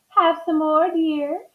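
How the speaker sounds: high-pitched doting wife; dreaming Missus Watson. A narrator's reading. Missus Watson serving toast in her sleep, high dreamy offer. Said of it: "Missus Watson said".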